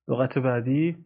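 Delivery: usual speaking voice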